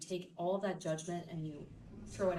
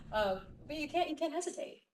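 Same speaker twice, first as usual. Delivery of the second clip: ordinary talk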